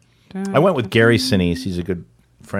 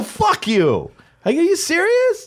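mock disbelief; slower than usual. Disbelieving passenger reacts to pilot.

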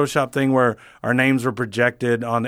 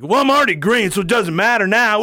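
high, loud hammed-up bartender; yelling; 10 semitones higher, 3 dB louder than usual. Green-beer bartender to the comics, rowdy high yelling. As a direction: shout it with rowdy, carefree abandon. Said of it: "Just yelling".

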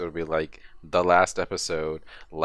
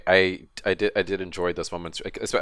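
ordinary talk

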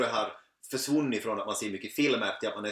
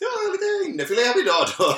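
high, loud playful put-on voice; mock gourmet survivor; 8 dB louder than usual. Jokingly voices a survivor choosing fine meat. (tillgjort förtjust, ljus och hög röst)

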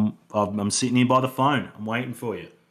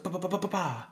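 finger gun noises; 9 semitones higher, 9 dB quieter than usual. Retold or imitated. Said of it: "He was like".

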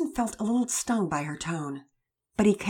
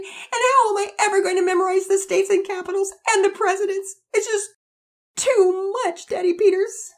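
put-on higher and louder, a little girl crying through her words; tearful lament; 7 dB louder than usual. Girl to her father about school, crying and pleading. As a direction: pour out every worry between sobs. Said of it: "her tears began to flow more rapidly and her sobs grew louder".

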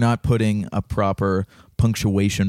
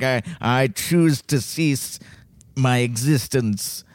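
solemn dinosaur voice; 3 semitones higher, slower than usual. Acted. Dinosaur ending its life, voiced.

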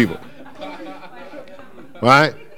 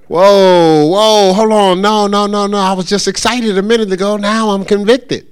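mock alarm; 5 semitones higher, 3 dB louder than usual. Acting a caller suddenly convicted.

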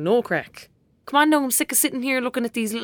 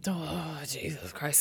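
exasperated groan; 7 semitones lower, 13 dB quieter, slower than usual. Acted. Exasperated at kitten plan.

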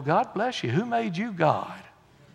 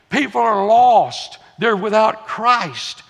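loud, slow, raised-pitch declaration; fervent, stretched-out proclamation; emphatic preaching; 5 semitones higher, 8 dB louder, slower than usual. Real, not acted. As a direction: drive each phrase home from the pulpit. Preacher's forceful climax.

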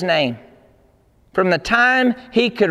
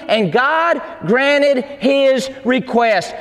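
slow, drawn-out shouted proclamation; triumphant shout; much slower than usual. Acted. Preacher to congregation, triumphant slow shout. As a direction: proclaim it to the whole church.

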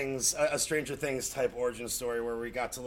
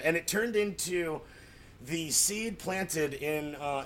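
usual speaking voice

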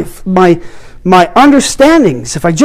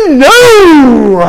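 a higher, louder crying no; 5 semitones higher, 7 dB louder than usual. Retold or imitated. Refusing God's call, crying out no. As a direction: refuse it with a sob. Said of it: "And I was like".

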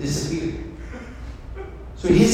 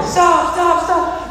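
high, frantic warning shouts; acted screaming and hollering; 12 semitones higher, 3 dB louder, much slower than usual. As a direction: holler desperately to halt the bus. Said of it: "screaming, hollering".

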